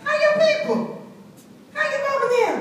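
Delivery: put-on high, bright little-girl call; waving and hollering hello from the gatepost; child greeting passing cars. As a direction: call out like an eager small child to strangers. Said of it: "I would say something like".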